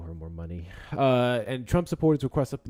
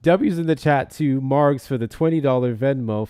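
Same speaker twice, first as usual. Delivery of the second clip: hype shout-out; 3 semitones higher, 10 dB louder than usual. Real, not acted. Shouting out donations.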